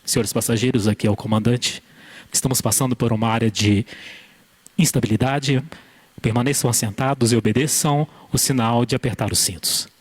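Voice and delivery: calm, deep airline captain announcement; pilot cockpit headset. Retold or imitated. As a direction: speak like the captain over the cabin speaker. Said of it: "vem aquela voz".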